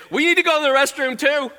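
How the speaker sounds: high, loud copycat pupil plea; eager pupil request. Acted. Next pupils copy the first request.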